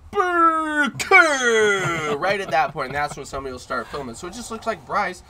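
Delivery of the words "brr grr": loud, high mock growl; growl noise. Man acting out losing his temper, loud mock growl. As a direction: snarl "Brr, grr" like an angry dog. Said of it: "when I'm like".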